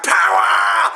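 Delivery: hammed-up evil emperor screaming for power; ranting Palpatine. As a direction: yell it like a power-mad emperor. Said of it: "Palpatine's like".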